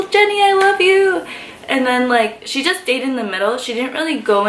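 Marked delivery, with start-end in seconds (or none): 0.1-1.2 high, loud call across the crowd. Retold or imitated. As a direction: shout it to her over the crowd. Calling out to idol in crowd.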